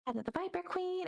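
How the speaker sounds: high, quick clerk repeating the request; halfling receptionist. Acted. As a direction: echo it back briskly while already busy. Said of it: "receptionist repeats request".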